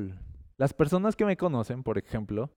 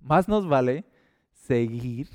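ordinary delivery

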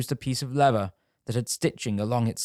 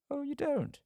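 mock surprise; 6 dB quieter than usual. Tanner mocks the denial.

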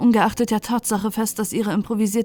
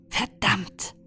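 leise gedachter Fluch; 6 dB quieter than usual. Acted. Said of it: "dachte Slizza".

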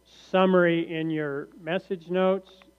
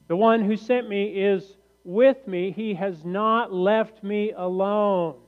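slow, emphatic verse reading, louder at first; declaimed scripture reading; 3 semitones higher, 4 dB louder than usual. Acted. Preacher quotes Jesus to the congregation, slow and emphatic. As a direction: draw the verse out with weight.

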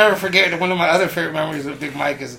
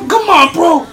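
high loud urging; pushy hype; 11 semitones higher, 9 dB louder, slower than usual. Retold or imitated. Cruz still loudly pressuring Big Mike. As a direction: keep yelling him into it. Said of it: "Cruz was like".

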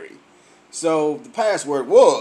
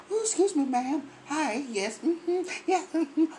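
high, soft customer-service voice; polite work voice; 6 semitones higher, 8 dB quieter than usual. Acted. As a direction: imitate your sweet, quiet, over-polite self at work. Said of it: "At work, it's like".

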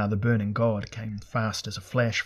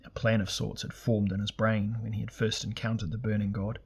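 usual speaking voice

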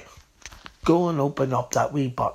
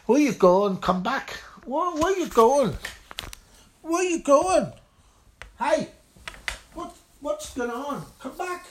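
rising, pleading calls, high; calling after; 11 semitones higher than usual. Acted. Karen calls after the offended man, high and urgent. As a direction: call after him as he walks off.

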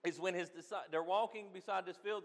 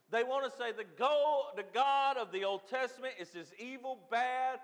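raised, loud pulpit delivery; impassioned preaching; 6 semitones higher, 7 dB louder than usual. Real, not acted. Preacher mocking a false view of God, impassioned. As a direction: preach it forcefully, pitched up.

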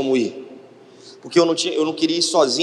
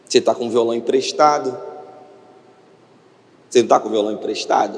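lightly lowered voice for a divine question; Espírito Santo; 6 semitones lower than usual. Retold or imitated. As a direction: gently press the point in a lower register. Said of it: "eu senti o Espírito Santo falou". (pergunta divina em tom mais grave)

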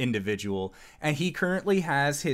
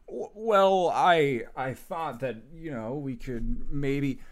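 flustered; 3 semitones higher than usual. Acted. Flustered employee stammers excuse.

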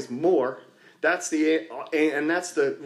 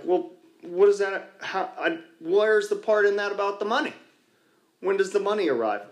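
higher, indignant mock complaint; mock whiny newcomer; 6 semitones higher than usual. Newcomer complains to his sponsor, mock indignant and higher. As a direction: play the impatient newcomer asking for his cash, pitched up. Newcomer demanding money answers.

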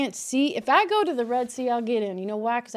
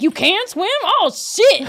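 loud, high burst of amazement; be hugely impressed by a tiny feat; amazed; 7 semitones higher, 7 dB louder, slower than usual. Acted. Podcaster awed that someone can swim, amazed. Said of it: "I'm surprised if anybody can".

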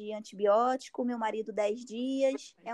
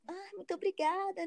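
meek thanks; 6 semitones higher than usual. Retold or imitated. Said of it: "e eu quietinha".

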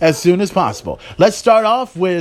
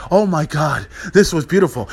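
usual speaking voice

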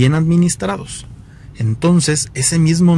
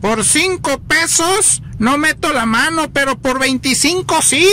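put-on high northern Mexican accent, triumphant; norteño accent; 12 semitones higher than usual. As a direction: deliver the punchline as the norteño, pitched way up. (acento norteño muy agudo)

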